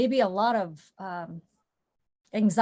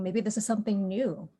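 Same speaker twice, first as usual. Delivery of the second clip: normal talking voice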